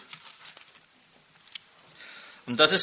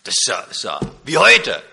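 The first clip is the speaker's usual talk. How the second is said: visitor's amazement, louder by the end; astonished guest asking which book this is from; amazed visitor; 7 semitones lower, 4 dB louder than usual. Retold or imitated. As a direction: play the stunned businessman hearing the text. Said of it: "hat ihm den Text vorgelesen und sagt".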